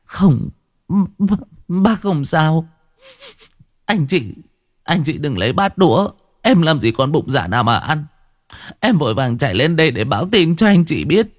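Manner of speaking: vừa khóc vừa lắp bắp, giọng cao hẳn lên; cô em hớt hải báo tin dữ, nghẹn ngào khóc; tearful stammer. A narrator's reading. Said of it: "nó ôm mặt khóc lên tu tu". (giọng nghẹn ngào mếu máo, cao vút)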